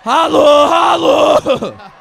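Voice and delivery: high, loud shouted holla; rapper call. Retold or imitated. Guest mimics [name] calling out to the room. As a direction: shout it like a rapper hyping a crowd.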